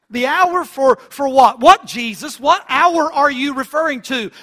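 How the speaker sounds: insistent questioning. Acted. Preacher demanding an answer from Jesus, insistent questioning. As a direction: press for an answer with rising force.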